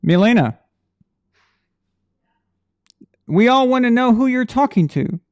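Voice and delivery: loud, high call to someone in another room. Real, not acted. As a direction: holler it across the house. Calls out to someone off mic.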